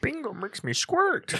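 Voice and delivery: put-on high, slow old lady voice. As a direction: play a sweet little granny, drawn out. Mocking the old lady sign.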